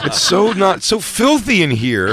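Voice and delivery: high, loud, fussy complaining; whiny jailed man. Pretend. Himself imagining jail, high loud whining. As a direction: whine like a pampered new inmate.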